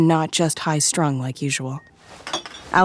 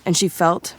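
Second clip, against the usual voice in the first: ordinary delivery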